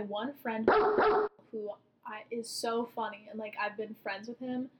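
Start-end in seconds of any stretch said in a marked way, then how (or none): none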